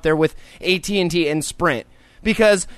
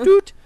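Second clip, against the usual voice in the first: mock doot sound; 10 semitones higher, 4 dB louder than usual.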